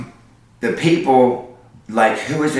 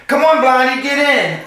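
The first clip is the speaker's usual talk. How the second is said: coaxing flirty driver; 12 semitones higher than usual. Retold or imitated. Man in truck coaxing her.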